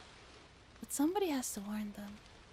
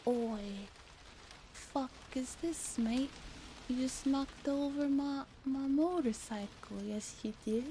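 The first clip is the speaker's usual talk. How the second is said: hammed-up British accent, mildly menacing; 2 semitones higher, slower than usual. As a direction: growl it like an angry London biker. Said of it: "sounds British".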